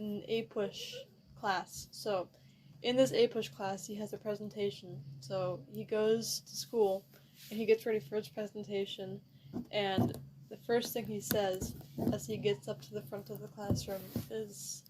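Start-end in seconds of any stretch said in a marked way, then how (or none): none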